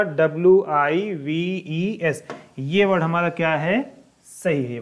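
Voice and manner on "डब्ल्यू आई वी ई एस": letters spelled out slowly one by one; slow spelling. Shown as a demonstration. Teacher to students: spells the word out slowly. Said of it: "सही word है".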